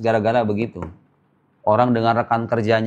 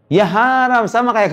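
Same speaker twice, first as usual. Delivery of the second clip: emphatic; 10 semitones higher, 4 dB louder, slower than usual. Real, not acted. Answering own question emphatically.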